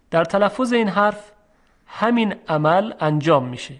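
ordinary talk

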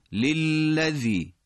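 للذی very slowly chanted; slow recited للذی; recited model. Shown as a demonstration. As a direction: draw the lams out in للذی. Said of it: "سه تا لام پشت سر هم اومده".

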